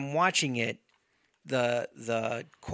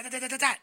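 stabbing sound effects; 10 semitones higher, much faster than usual. Retold or imitated. Imitating stabbing motions.

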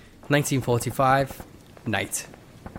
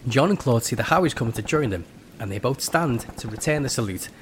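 usual speaking voice

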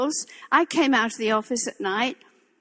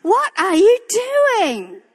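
exasperated question; 6 semitones higher, 4 dB louder, slower than usual. Retold or imitated. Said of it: "Don't we sometimes say".

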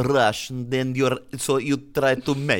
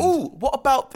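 sudden idea; 3 dB louder than usual. Real, not acted.

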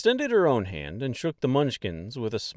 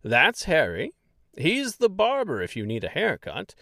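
higher, proud pointing out neighbours; chatty tour-guide mayor; 9 semitones higher than usual. Acted. Mayor points out townsfolk to Dorothy, proud chatter. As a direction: show off the village.

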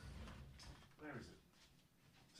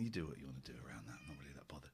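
fast, softer throwaway whisper; whispered aside; 11 semitones lower, 12 dB quieter, much faster than usual. Real, not acted. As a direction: toss it off under your breath. Muttered aside about subscribing.

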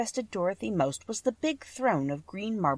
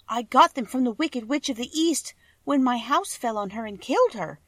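put-on a touch higher, small girl voice; a hint of a meek child asking for help; lightly voiced Dorothy, a bit higher; lightly voiced little girl; 5 semitones higher, 4 dB louder than usual. A narrator's reading.

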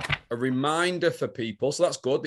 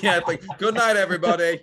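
laughing mock sign-off; 5 semitones higher, 4 dB louder than usual. Mock signing off the podcast.

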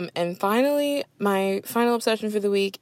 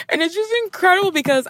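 much higher, fast gushing; delighted; 12 semitones higher, faster than usual. Real, not acted. Host raving about the show, high and delighted. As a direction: squeal it with glee.

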